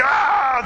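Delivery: high exasperated "aah"; frustrated yell. Retold or imitated. Man retelling his botched car repair, frustrated yell. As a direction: cry out as if fed up with the repair. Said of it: "I'm just getting so frustrated".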